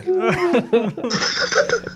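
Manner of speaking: high helpless laughing fit; spit take laughter. Real, not acted. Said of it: "an actual spit take".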